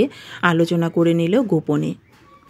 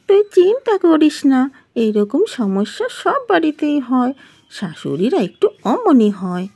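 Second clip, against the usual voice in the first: high-pitched friend voice, easing down; reassuring friend; 6 semitones higher than usual. Acted. Neighbour friend consoling the daughter-in-law, reassuring. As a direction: open high and lilting, then settle her worry.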